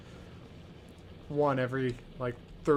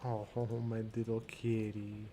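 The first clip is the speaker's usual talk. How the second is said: soft cooing; 3 semitones lower, 5 dB quieter than usual. Real, not acted. Cat cuddling on her lap.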